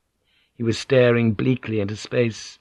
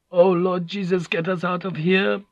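high, thin-voiced frightened prayer; whimpering; 9 semitones higher than usual. A narrator's reading. Prisoner praying to escape the pit, whimpering. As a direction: whimper it pitched well above normal. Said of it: "someone whimpered".

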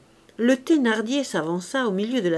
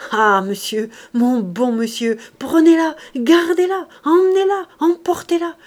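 loud, high, mounting eagerness; overjoyed, climbing higher with each plea; hideous glee; 7 semitones higher, 9 dB louder than usual. Acted. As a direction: gush and urge him on. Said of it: "s'illumina d'un épanouissement hideux".

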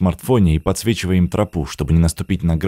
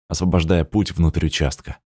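ordinary delivery

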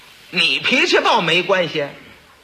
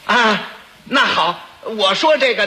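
normal talking voice

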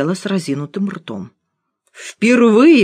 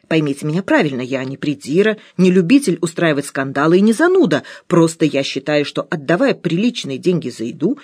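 usual speaking voice

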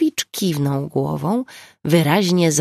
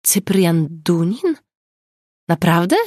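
surprised; 2 semitones higher, 4 dB louder than usual. A narrator's reading. Blinks at the surprising name.

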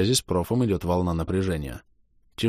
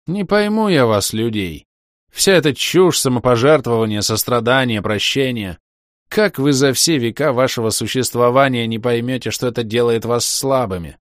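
loud, higher contempt; scornful tirade; 5 semitones higher, 6 dB louder than usual. Acted. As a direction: lecture with contempt. Шир презрительно поучает. (презрительная тирада)

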